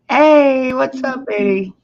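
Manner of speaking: high bright hey to a friend; excited greeting. Real, not acted. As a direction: greet him with a big lift. Greeting newcomer on stream.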